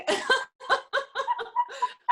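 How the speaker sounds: high burst of laughter; laughing. Real, not acted. Laughs about the cold.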